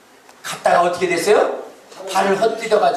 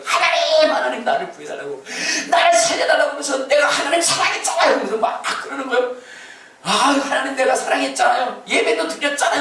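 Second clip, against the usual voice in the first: pleading with God; 8 semitones higher than usual. Retold or imitated. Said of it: "하나님을 찾는 거예요".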